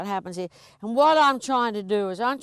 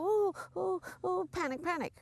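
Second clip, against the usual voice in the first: panicky horse; 7 semitones higher, 9 dB quieter than usual. Retold or imitated. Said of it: "and they start".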